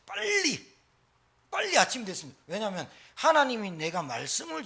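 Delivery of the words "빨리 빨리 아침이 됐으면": high, longing call of the word; a yearning high-pitched quick; eager cry. Acted. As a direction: sing out the eagerness.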